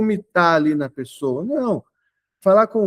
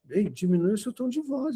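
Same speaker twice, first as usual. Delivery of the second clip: much softer, restrained request; tom de voz controlado; 2 semitones higher, 9 dB quieter than usual. Retold or imitated. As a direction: drop the volume to calm someone shouting. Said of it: "eu conversava nesse meu tom de voz". (pedido contido, em voz baixa)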